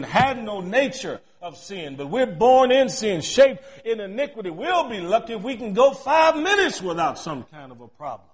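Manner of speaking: loud, driving preacher's cadence; preaching at full force. Acted. Pastor preaching sin to the congregation, full-force preaching.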